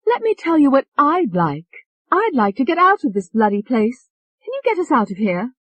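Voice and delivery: put-on high, loud, pushy English accent; brash English accent. Tony demanding to get out, brash English accent. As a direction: cut him off, brash and English.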